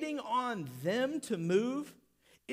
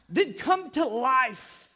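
loud, slow, drawn-out call; forceful preacher's shout; commanding shout; 3 semitones higher, 10 dB louder, much slower than usual. Acted. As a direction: cry it out as a summons. Preacher voices Jesus calling to man.

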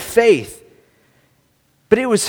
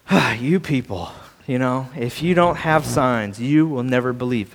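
low, softer, with a dismissive sigh; weary put-down, pitched low; demeaning; 8 semitones lower, 5 dB quieter than usual. Acted. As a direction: sigh and talk down to them. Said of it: "this sounds demeaning".

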